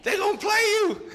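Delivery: very high, loud, overjoyed yell; buddy shouting, sure his friend will go in; hyped shout. Retold or imitated. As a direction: scream it like your friend just got picked. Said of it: "Speedy's crunk. You can't tell him nothing".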